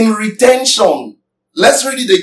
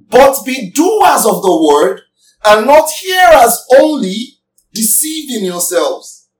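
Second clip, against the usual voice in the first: slow, weighty reading of scripture. Acted. Preacher reads the verse to the congregation, slow and weighty. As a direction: read each phrase slowly and let it land.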